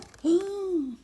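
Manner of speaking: sharp theatrical intake of breath; dramatic gasp. Acted. Reader gasping at the scary part, dramatic gasp.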